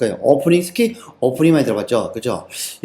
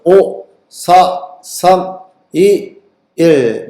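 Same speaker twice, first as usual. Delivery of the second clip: slow, evenly spaced, higher count; countdown; 3 semitones higher, 4 dB louder, much slower than usual. Shown as a demonstration. Teacher timing students on a question, slow countdown. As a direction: count down number by number, leaving gaps.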